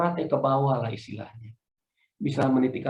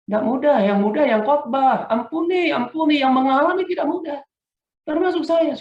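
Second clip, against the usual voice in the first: raised, high preacher's call; fervent sermon delivery; preaching; 11 semitones higher, 6 dB louder than usual. Acted. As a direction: drive the point home to the church. Preaching at full force.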